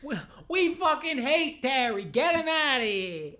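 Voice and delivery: high, slow mock mobster; Italian guy voice. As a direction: ham up the Italian mob guy. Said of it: "my Italian guy bit".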